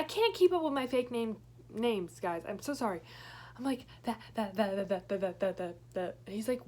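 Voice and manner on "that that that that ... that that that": repeating "that" over and over, stuck; mock stutter. Panicked girl stammers "that" to her friend Joe. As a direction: stammer on "that" like you can't get past it. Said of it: "Stop stuttering".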